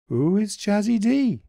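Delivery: high, small puzzled bunny voice; a high, wondering little creature; Daily B's voice. A narrator's reading. Daily B asks.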